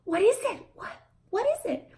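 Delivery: very high exaggerated question; high exaggerated parentese; expressive questioning. Shown as a demonstration. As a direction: use your face and voice to ask. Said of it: "you look at them and you go".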